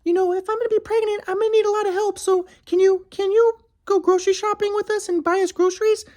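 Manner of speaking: high falsetto imitation of his ex, pleading; mocking his ex. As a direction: mimic her in a high girly whine. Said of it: "she was like".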